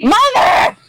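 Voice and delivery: loud call across the house; shouting for mom. Real, not acted. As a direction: yell for mother, rising. Calling mom while streaming.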